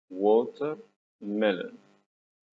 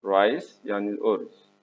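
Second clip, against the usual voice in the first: ordinary talk, nothing marked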